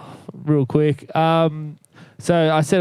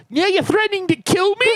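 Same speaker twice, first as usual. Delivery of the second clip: high, whiny imitation of the girlfriend; hammed-up outraged partner; mock girlfriend voice. Imitating girlfriend in retold argument.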